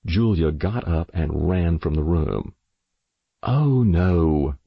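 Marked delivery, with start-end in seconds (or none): 3.4-4.5 louder, exasperated mother; exclaiming. Acted. Mother exclaims as her daughter runs from the room, louder and exasperated. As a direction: sigh it out in exasperation. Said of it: "Mother exclaimed".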